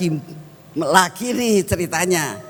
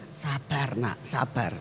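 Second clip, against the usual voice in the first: much softer gentle "sabar"; soft calming voice; 6 semitones lower, 10 dB quieter, slower than usual. Retold or imitated. Holy Spirit quietly calming the preacher, soft and gentle. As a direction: hush and soothe. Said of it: "Dan Roh Kudus".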